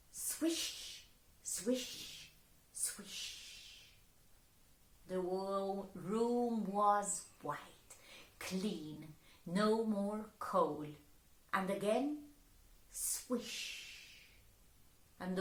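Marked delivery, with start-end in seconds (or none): 0.1-3.9 soft unvoiced wand swish; soft unvoiced magic swish; whispered swish. Wand sound. 12.9-14.4 soft unvoiced wand swish; soft unvoiced magic swish; whispered swish. Wand sound.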